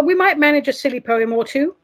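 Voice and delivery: ordinary talk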